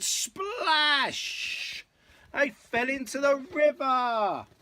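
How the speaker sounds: high, loud, stretched-out exclamation; dramatic climax. A narrator's reading. Parent reads the bridge collapse to child, dramatic climax. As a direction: blast out the big splash moment.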